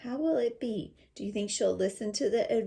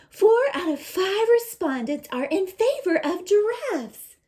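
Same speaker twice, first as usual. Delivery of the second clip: put-on excited girl; 11 semitones higher, 9 dB louder than usual. Girl presents results.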